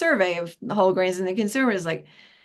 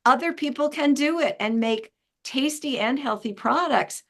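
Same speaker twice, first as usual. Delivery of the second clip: ordinary talk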